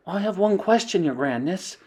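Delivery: a hint of a nervous witch; lightly voiced timid witch. A witch in the audience asking the leader, timid. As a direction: ask it carefully. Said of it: "said a voice in the audience".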